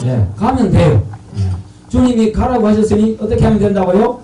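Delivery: loud, emphatic sermon delivery; forceful preaching. Real, not acted. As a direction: preach it out to the congregation, loudly. Preaches call and response at force.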